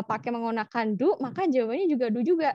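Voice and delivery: ordinary delivery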